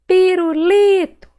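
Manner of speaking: "Pirulito" slow and loud, high long LI; stretched stressed syllable. Shown as a demonstration. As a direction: call out "Pirulito", holding LI. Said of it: "Qual que eu demorei mais falar".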